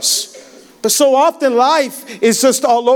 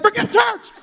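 exasperated outburst; 10 semitones higher than usual. Retold or imitated. Frazzled parent snapping, laughing.

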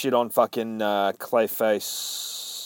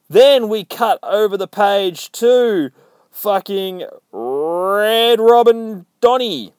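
high, loud, drawn-out announcer cry; hyped-up reveal; 10 semitones higher, 10 dB louder, slower than usual. Acted. Reviewer reveals the next character, slow high hyped shout. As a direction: stretch the name like a ring announcer.

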